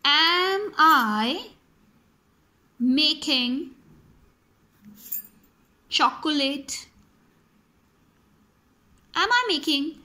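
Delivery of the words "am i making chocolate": slow word by word. Shown as a demonstration. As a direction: question spaced out word by word. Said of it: "my question can be".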